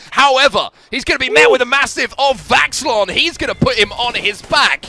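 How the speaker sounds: loud, high play-by-play burst; big esports caster hype; hype call. Real, not acted. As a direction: call the knockdown with rising excitement. Caster calling a big fight moment.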